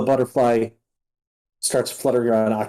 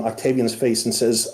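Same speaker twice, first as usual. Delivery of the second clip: ordinary talk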